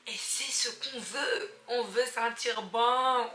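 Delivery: BON drawn out long and loud; emphatic enthusiasm. Real, not acted. Vlogger to viewers on smelling good, emphatic enthusiasm. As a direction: stretch the words slowly, landing big on BON.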